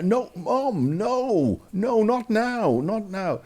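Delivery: high, drawn-out pleading to mum; panicked protest. Retold or imitated. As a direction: plead urgently in a high, stretched voice. Son protesting during mother's phone call.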